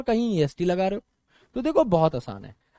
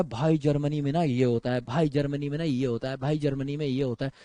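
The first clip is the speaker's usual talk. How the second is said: quoting a friend, lower and softer; low, softer imitation of a chatty friend; friend's boasting, retold; 5 semitones lower, 4 dB quieter than usual. Teacher quoting his friend about life in Germany, retold boast. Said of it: "वो बताता है".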